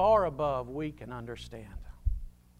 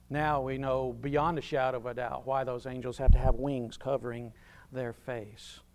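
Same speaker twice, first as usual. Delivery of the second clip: normal talking voice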